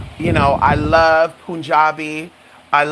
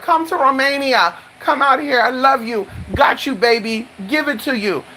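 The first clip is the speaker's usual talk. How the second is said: imitated suitors; 8 semitones higher than usual. Imitates Romanian men wooing her.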